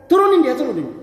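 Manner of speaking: urgent retold command; acted command. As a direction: play a king ordering his men. Acted king ordering prisoner taken down.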